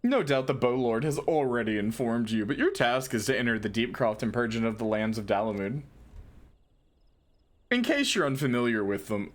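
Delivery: lightly voiced dumb jock. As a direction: read it like a laid-back bro. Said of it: "I'm just gonna do, like, a jock voice".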